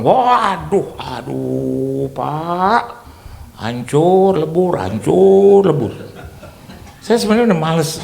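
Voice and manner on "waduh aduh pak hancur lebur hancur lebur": slow, drawn-out groaning lament; complaining businessman. Retold or imitated. Friend groans to the pastor about business. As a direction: moan that it's all ruined, dragging the words. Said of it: "Ngomongnya begitu".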